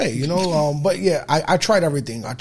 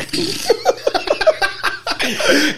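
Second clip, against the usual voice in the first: real laughter rising high; high burst of laughter; 5 dB louder than usual. Real, not acted. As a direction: crack up at the punchline. Laughs at son's remark.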